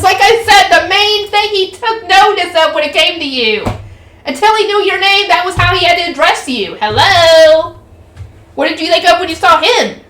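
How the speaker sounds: high-pitched, exasperated explainer; exasperated character. Acted. Voices a character in the story.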